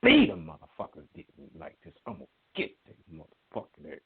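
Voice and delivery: low, muttered mock fury; quietly mad, trailing down under the breath; retold seething anger. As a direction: imitate someone fuming to himself, sinking very quiet. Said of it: "you could just see he was mad".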